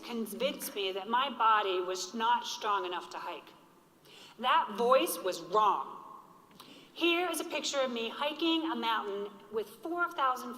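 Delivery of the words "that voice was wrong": slow and louder, each word weighted; firm refrain. Real, not acted. Survivor defying her inner doubt, slow and firm. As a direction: lay it down like a verdict.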